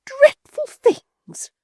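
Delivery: very high, loud, slow outraged echo; drawn-out high indignant echo; scornful repeat. A narrator's reading. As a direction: shriek the words back in disbelief. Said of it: "repeated Missus Chick".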